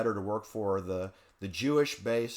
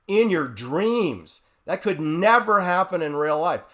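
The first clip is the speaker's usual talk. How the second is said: scornful disbelief; 5 semitones higher, 7 dB louder than usual. Real, not acted. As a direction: dismiss it loudly as utterly impossible. Scoffing at an impossible startup story.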